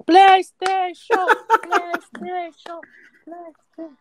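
high slow shout dying to a whisper; drawn-out call fading to whisper. Pretend. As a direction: call it out long, then fade to a whisper.

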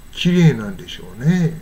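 ordinary talk, nothing marked

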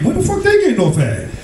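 high, incredulous question; baffled disbelief. Retold or imitated. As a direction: puzzle aloud in a high voice. Quotes his own baffled thought.

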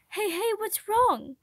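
put-on high, slow, soothing voice to a crying child; slow gentle hush, pitched high; gentle comfort. A narrator's reading. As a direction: ease the child out of his tears. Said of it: "Princey gently asked".

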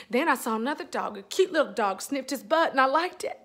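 ordinary talk

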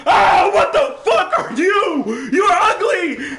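high, loud, slow shrieks at the monster; scared shouting. Real, not acted. Gamer face to face with the monster, scared shouting. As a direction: scream your disgust and fear.

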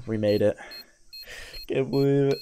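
ordinary delivery

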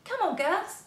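put-on high, bright call to the girls; lightly voiced boy urging them on. A narrator's reading. Jo urging the girls up the tree, high call. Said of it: "he said".

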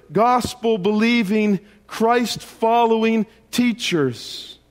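fiery preaching. Acted. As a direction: drive each question home to the congregation. Preaches Corinthians at full force.

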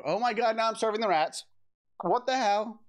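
exasperated. Real, not acted. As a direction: throw up your hands.

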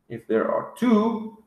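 ordinary talk, nothing marked

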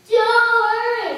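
high, loud, slow scared troll; terrified troll. Acted. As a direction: blurt it out in terror. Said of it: "said the terrified troll".

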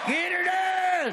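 high, drawn-out holler; big high-pitched catchphrase yell; catchphrase shout. Shown as a demonstration. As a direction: shout the signature line high and slow for the crowd. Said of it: "I should do this".

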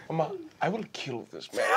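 low, soft, deadly serious; quiet threat. Acted. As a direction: threaten it low, under your breath. In-character threat to mother.

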